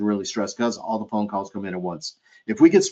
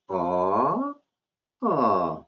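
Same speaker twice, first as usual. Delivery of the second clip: sympathetic aww. Shown as a demonstration. Clerk reacting to a guest's new baby with a tender aww. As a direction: coo at the news of a new baby. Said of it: "Follow the fingers".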